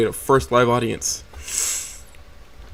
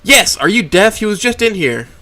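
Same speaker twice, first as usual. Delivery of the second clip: exasperated snap; 10 semitones higher, 5 dB louder than usual. Acted. Snapping at inattentive boss.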